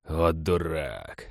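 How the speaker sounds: low, slow grumble; muttered aside. A narrator's reading. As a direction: mutter it softly, drawn out. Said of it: "пробормотал череп".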